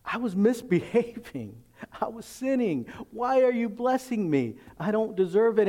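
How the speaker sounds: high, amazed disbelief; can't believe you're being blessed; a stunned believer thanking God, high and amazed; overwhelmed gratitude. Retold or imitated. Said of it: "you're like".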